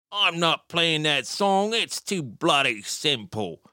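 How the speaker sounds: put-on slow, drawn-out Cockney accent, lifted at the start; mock Cockney guitarist, sniffy and slow; Vinnie Riley Cockney accent. Quoting the guitarist refusing a song, in a slow Cockney accent. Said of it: "Do you like that Cockney accent?".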